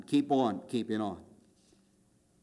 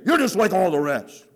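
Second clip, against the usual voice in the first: a hint of a loud accusing woman, higher and louder; pastor's wife's big voice; 5 semitones higher, 7 dB louder than usual. Pastor's wife accusing the preacher, mildly acted loud rebuke. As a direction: call him out from the second row. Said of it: "big, deep voice".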